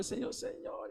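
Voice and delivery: high, hushed mock crying; imitated tearful pleading. Preacher reenacting his crying complaint to the Lord, tearful pleading. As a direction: cry out softly in a thin, high plea. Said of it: "estaba llorando, yo le, reclamándole".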